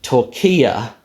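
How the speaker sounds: Turkiya as "tor-KEE-uh"; English pronunciation. Shown as a demonstration. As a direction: stress KEE in Turkiya and drop to "uh". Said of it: "he came down to uh".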